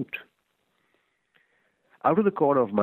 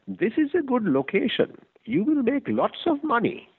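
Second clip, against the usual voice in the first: much higher immigrant shopkeeper selling; previous owner's voice. Acted. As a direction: pitch the shop like the eager seller.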